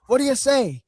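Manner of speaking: loud, high mock promo shout; mock Piper mic call. Host mocks Piper cutting in again, loud and high. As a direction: bark it like a pushy announcer.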